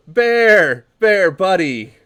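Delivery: very loud, high happy shout; hailing his buddy at the door; joyful greeting. Acted. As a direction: call out to an old friend with delight.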